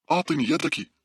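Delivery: high, urgent command; urgent calling out. A narrator's reading. Girl holding doors calls to her friend, urgent high voice. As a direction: call out for the hand in a rush.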